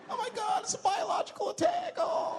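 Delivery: very high screaming panic; panicked shriek. Retold or imitated. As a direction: shriek like a terrified passenger. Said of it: "everybody freaks out".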